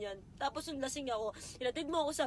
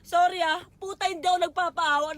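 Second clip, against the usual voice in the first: crying apology; 4 semitones higher, 8 dB louder than usual. Real, not acted. Speaking to her ex, overwhelmed while retelling pain, sobbing. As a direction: voice breaking into loud sobs, louder. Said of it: "nadadala lang talaga ako".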